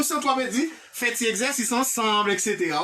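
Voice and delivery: usual speaking voice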